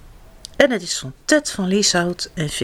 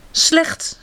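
plain reading voice

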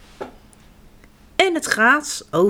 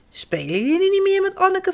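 higher curious mother's voice; mother asking; 10 semitones higher than usual. A narrator's reading. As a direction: mum wondering about the doll. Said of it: "vraagt mama". (hogere nieuwsgierige moederstem)

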